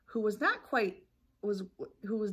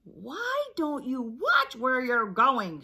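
slow, loud, put-on goose honk; 5 semitones higher, 7 dB louder, slower than usual. Goose scolds those who bumped her, slow honk. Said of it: "she honked".

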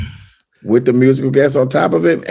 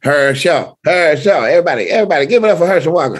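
raised-pitch celebrity impression; Herschel Walker; 5 semitones higher, faster than usual. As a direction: announce yourself in an imitated high voice. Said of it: "literally talks like that".

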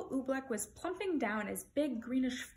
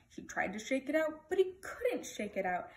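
ordinary delivery

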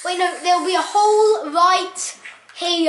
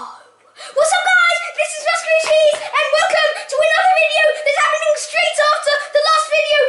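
YouTuber intro; 10 semitones higher than usual. Acted. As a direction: hype up the channel greeting.

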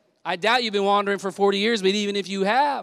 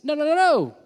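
high, far-off emphatic no; hammed-up divine refusal; voicing God; 9 semitones higher than usual. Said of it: "He said".

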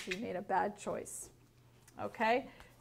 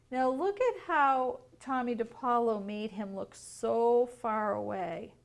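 ordinary delivery

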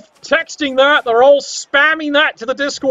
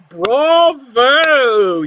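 ordinary talk, nothing marked

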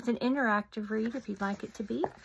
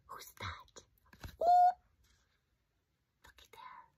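hushed surprise. Acted. Reader spotting a hidden frog, whispering in surprise. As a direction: gasp then whisper.

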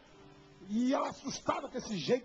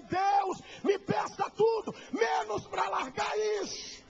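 retold plea; 9 semitones higher, slower than usual. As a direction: beg God at full voice, stretching the words. Mother's plea to God, retold. (súplica alta e lenta, gritada ao céu)

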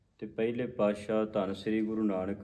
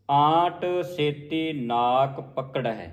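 slow, higher recitation ending in a stop at ਪਕੜਹਿ; rest on ਪਕੜਹਿ; 4 semitones higher, 4 dB louder, much slower than usual. Shown as a demonstration. Teacher showing the rest, slow halt after ਪਕੜਹਿ. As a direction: draw it out, pause after ਪਕੜਹਿ. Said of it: "ਪਕੜਹਿ ਤੇ ਵਿਸਰਾਮ ਲਾਉਣਾ ਹੈ".

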